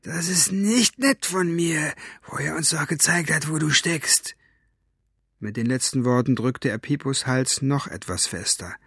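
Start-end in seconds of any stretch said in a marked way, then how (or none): 0.0-4.4 mocking villain. A narrator's reading. Villain mocks frightened girl. (spöttisch gedehnte, hohe Schurkenstimme)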